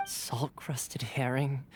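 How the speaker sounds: slow, breathless whispered listing; dazed whisper. Acted. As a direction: murmur it half out of it. Dazed Thoma listing weird ingredients.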